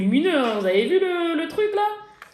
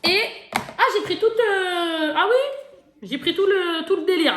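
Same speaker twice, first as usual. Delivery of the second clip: higher, louder burst of excitement; excited; 9 semitones higher, 4 dB louder than usual. Real, not acted. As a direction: gush over everything she bought. S'enthousiasme sur ses achats. (emballée par tout le délire, voix plus aiguë et plus forte)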